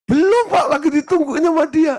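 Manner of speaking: very high, loud phone voice; acted caller protest. Acting out the caller's answer.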